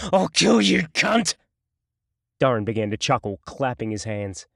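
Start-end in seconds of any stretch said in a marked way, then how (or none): 0.1-1.3 loud high furious scream; screamed defiance. A narrator's reading. Jason screaming defiance at Duran, furious tearful scream. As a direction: spit the threat through tears. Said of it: "screamed his defiance".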